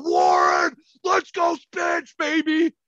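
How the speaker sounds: high, drawn-out holler; drunken fan shout. Retold or imitated. Hammered fan hailing a former player, high drawn-out shout. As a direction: call out to your hockey idol.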